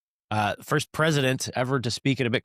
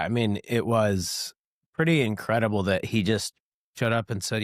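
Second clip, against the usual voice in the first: normal talking voice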